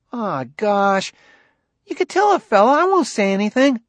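put-on high, protesting kid; young son Ben, pleading. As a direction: wheedle dad in a boy's voice.

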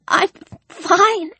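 put-on high, halting girl's voice; tearful. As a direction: insist through held-back tears. Tearful girl held by Alex.

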